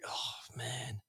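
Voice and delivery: breathy whispered groan of dismay. Retold or imitated. Whispering to himself on hearing the new opponent. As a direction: sink into a whispered oh man. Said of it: "And I'm like".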